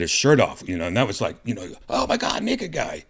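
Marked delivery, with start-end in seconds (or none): 1.9-3.0 a gasp of shocked disbelief; shocked thought. Retold or imitated. Seeing a shirtless body dragged past, startled inner reaction.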